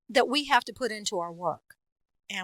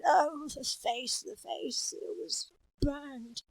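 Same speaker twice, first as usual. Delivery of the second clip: slow, breathy horrified whisper; 5 semitones higher, 6 dB quieter, much slower than usual. Acted. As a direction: recoil in horror, slowly. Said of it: "someone who had".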